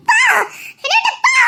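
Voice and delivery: high, shrieking laugh-cry; crying scream. Acted. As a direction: scream him away in tears. Final screaming rejection. (கணவனை விரட்டும் அழுகைக் கதறல்)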